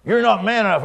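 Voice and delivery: high-pitched imitated goading; baiting taunt. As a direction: mimic a heckler daring someone. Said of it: "He was trying to bait me".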